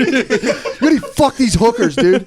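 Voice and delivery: high, slow, laughing through the line; half-joking impression cracking up; laughing mock rant. Voicing Van Gogh scolding his friend, laughing high and slow. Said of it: "acting out Van Gogh".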